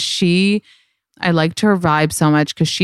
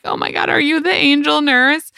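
mock awe; 10 semitones higher than usual.

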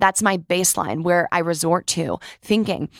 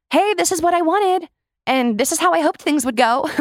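high, upbeat put-on approval; mock delight; 9 semitones higher than usual. Imitating someone saying things went as hoped, mock pleased. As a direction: pretend it all turned out great, pitched up.

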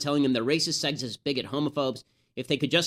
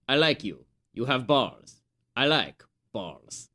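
movie terrorist voice; 3 semitones lower, slower than usual. Retold or imitated. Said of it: "the terrorist turns to him and says".

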